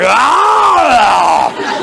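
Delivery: high, half-laughing mock yell; wood chopper shout. Shown as a demonstration. Therapist acts out the wood chopper move, laughing shout. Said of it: "do wood chopper".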